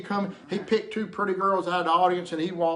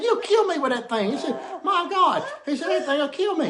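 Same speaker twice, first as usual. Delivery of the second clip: alarmed refusal; 10 semitones higher, 4 dB louder than usual. Retold or imitated. Drunk man refuses the leprechaun's offer, loud and alarmed. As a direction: back away in mock horror. Panicked, laughing teller.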